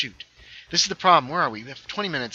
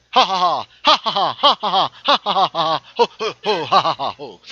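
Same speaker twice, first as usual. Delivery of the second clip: loud, higher string of put-on ha-ha's; hammed-up cackling; 6 semitones higher, 10 dB louder than usual. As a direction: cackle on request, hamming it up. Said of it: "But a lot more ha's than that".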